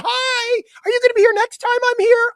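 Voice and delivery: very high, quick mock-female voice; imitated gushing women. Imitating women rushing over at the bar, high and gushing. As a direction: gush in a high put-on fan voice. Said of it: "remember the women were rushing over".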